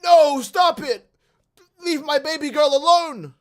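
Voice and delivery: high, slow, begging mock wail; mock whiny plea. Cuck boyfriend begging the bull, slow high whiny wail. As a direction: beg pathetically, drawn out. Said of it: "And you go".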